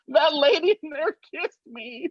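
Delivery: put-on high, awed little boy voice; dazed kid. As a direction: sound stunned and amazed. Dazed kid telling his mom.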